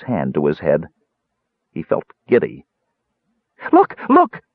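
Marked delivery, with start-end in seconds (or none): 3.7-4.4 high, loud, short cries; triumphant shout. A narrator's reading. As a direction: crow it in a tiny excited voice. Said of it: "Bupu shouted in triumph".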